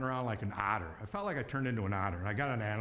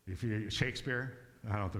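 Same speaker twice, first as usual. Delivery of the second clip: ordinary talk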